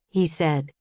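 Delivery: ordinary delivery, nothing marked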